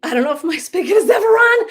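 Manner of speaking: high, loud comic lament; mock distress. Coach voices Sarah's comment on the spigot, mock distress. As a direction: wail it in playful exaggeration.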